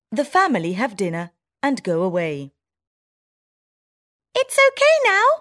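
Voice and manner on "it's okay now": high squeaky mouse, relieved; all clear in a tiny voice; town mouse voice. Acted. Town mouse telling her friend the danger's gone, high squeak. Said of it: "says the town mouse".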